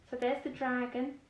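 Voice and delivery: ordinary delivery, nothing marked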